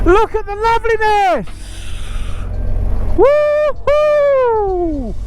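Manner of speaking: high, elated call across the view; joyful shout. Real, not acted. Vlogger shouting at the beautiful view, high elated whoop. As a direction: shout out your delight at the scenery. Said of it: "What am I shouting for?".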